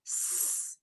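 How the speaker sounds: long voiceless hiss; S sound. Shown as a demonstration. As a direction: hold a soft "sss" alone. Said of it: "begin with the S sound".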